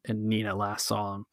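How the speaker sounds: ordinary delivery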